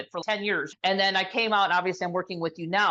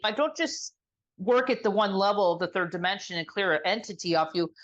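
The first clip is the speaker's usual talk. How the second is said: ordinary talk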